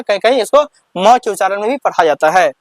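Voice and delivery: usual speaking voice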